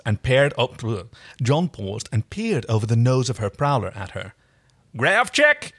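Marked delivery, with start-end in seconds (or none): 5.0-5.7 high-pitched puzzled repeat; cautious. Acted. John stumbles over an unfamiliar word, cautiously. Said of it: "he repeated cautiously".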